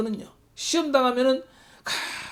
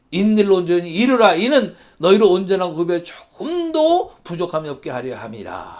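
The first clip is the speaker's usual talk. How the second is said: solemn measured Bible reading; scripture reading; 3 semitones lower than usual. Acted. Pastor reading James to the congregation, solemn. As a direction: read the verses out to the church. Rereads the verse.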